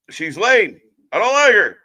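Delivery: high, loud scoffing jeer; mock hater shout. Mock hater jeering at Becky, loud and high. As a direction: sneer it loudly like a fake hater.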